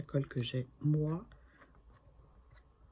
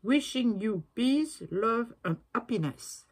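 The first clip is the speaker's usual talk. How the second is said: careful, slow English with a French accent, a bit higher; 6 semitones higher, 5 dB louder, slower than usual. Real, not acted. Reading her stamp aloud to viewers in halting French-accented English. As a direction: sound out the English words slowly in a French accent. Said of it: "je vais essayer de le lire".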